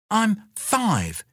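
put-on high, proud small child; little girl. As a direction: say your age like a five-year-old. Child answering.

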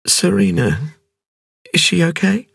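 high woman's voice, name rising into a question; anxious, asking after her doll; worried young woman. A narrator's reading. Injured woman asking Cavalier about her doll, high worried voice.

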